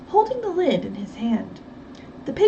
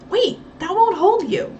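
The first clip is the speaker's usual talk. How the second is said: higher, louder sudden warning; alarmed warning; 9 semitones higher, 6 dB louder than usual. Acted. Nancy warning Effie about the chair, alarmed. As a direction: call out to stop her.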